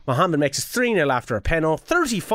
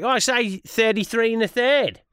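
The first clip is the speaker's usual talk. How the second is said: higher-pitched mock Australian; put-on Australian twang, pitched up; Australian accent; 5 semitones higher than usual. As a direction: imitate Australians showing off their "thirty-three". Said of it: "over in Australia, mate, they were all like".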